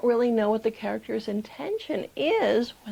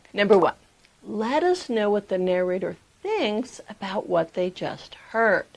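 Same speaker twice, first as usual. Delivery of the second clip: usual speaking voice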